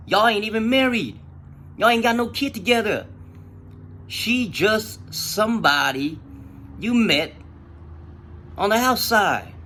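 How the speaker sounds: high, emphatic reality check; exasperated lecture. Retold or imitated. Speaker sets Monkey straight about his girlfriend, exasperated.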